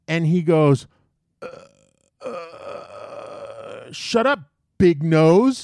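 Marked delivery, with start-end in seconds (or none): 1.4-5.7 mock stammer, then a louder blurt; shocked comeback. Shocked kid fires back at the man, mock-flustered retort. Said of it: "he looks at me and he's shocked".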